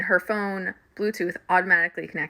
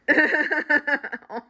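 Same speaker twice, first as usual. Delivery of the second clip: helpless laughter; 9 semitones higher, 5 dB louder than usual. Real, not acted. I died laughing.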